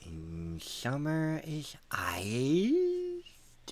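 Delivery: very slow, drawn-out whispery voice; hammed-up whispered riddle, rising in pitch; mock spooky whisper. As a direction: stretch each word into an eerie whisper. Playfully echoing the riddle.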